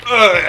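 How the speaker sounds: loud, high retching ugh; disgusted groan. Retold or imitated. Diner reacting to raw chicken, loud disgusted groan.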